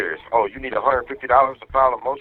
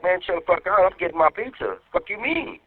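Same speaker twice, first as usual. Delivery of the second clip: irritated comeback; 6 semitones higher than usual. Retold or imitated. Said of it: "I'm like".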